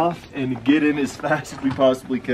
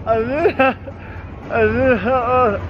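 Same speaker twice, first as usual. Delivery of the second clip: talking with tongue stuck to metal; garbled, can't move tongue; tongue stuck to pole; 5 semitones higher than usual. Real, not acted. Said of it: "Is it actually stuck".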